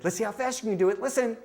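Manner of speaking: high, eager game voice; playful coaxing. Retold or imitated. To a crying girl playing the game, playful coaxing. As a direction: lift it high to hook a small child.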